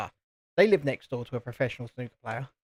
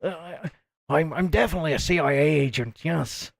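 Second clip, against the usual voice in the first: mock gruff Irish accent; lightly hammed-up gruff Irish impression; Liam Neeson. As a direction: imitate a failed American accent slipping into gruff Irish. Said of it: "comes out as this gruff Irish accent".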